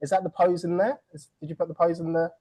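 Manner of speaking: ordinary talk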